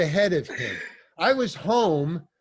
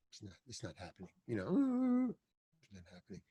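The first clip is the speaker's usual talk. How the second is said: fast, much softer, much lower; a nervous actor muttering to himself on set; under-the-breath panicked mumble; 8 semitones lower, 12 dB quieter, much faster than usual. Retold or imitated. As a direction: mutter it fast, almost swallowed. Actor failing to cry, panicking.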